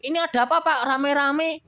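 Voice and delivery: high, loud, startled; Pak Juli alarmed. Acted. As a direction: demand to know why they came. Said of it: "kata Pak Juli". (Pak Juli kaget, suara tinggi dan keras)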